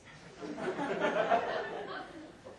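a high unvoiced burst of laughter; breathy laugh. Real, not acted. Narrator laughs mid-sentence.

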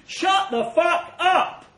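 slow, loud, high yell of rage; furious shout. Acted. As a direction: bark it out, dragging each word. Soldier snaps at the radio.

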